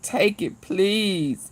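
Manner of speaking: ordinary delivery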